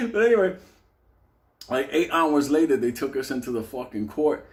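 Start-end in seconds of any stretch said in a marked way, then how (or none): none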